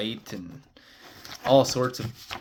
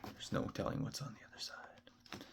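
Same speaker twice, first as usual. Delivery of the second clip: hushed, breathless whisper; 16 dB quieter than usual. Real, not acted. Warning viewers what lies past the portal, ominous whisper. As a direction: drop to a secretive whisper.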